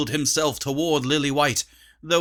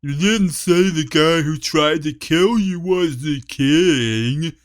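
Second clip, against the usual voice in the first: dopey voice; 2 semitones higher, 5 dB louder than usual. Acted. Dummy continues.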